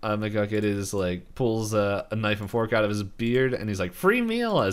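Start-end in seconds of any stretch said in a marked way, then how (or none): 3.9-4.6 high, gleeful hermit; high crazy old man voice. Retold or imitated. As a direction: squeak it out hungrily. Retelling McGucket line.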